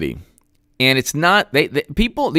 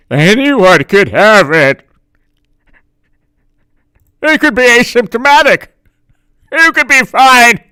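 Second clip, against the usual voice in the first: higher, louder, hammed-up alarm; mock panic; 8 semitones higher, 8 dB louder than usual. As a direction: whip up fake hysteria. Mocking lockdown panic argument.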